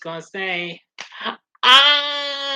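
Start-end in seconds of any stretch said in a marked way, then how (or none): 1.6-2.6 held scream, high and loud; one long loud "Ah!", pitched high but not straining; demo scream. Shown as a demonstration. Asked to scream on camera, saving the voice, demo scream. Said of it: "If you want me to scream".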